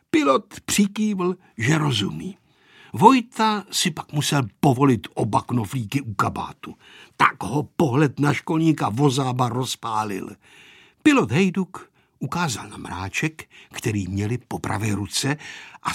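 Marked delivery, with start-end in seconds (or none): none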